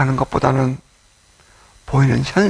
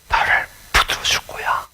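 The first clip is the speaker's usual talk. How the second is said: whispered promise; 4 dB quieter than usual. Retold or imitated.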